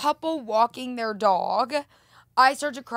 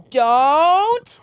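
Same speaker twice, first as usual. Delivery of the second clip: loud, raised don't; warning yell; 4 semitones higher, 9 dB louder than usual. Shown as a demonstration. Cyclist giving a verbal warning, loud yell. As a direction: holler it as a command. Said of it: "When you go".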